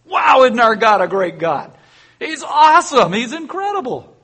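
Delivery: high, loud exultant praise; high excited crowd praise; joyful praise. Acted. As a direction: celebrate God's victory aloud. Israel praising God.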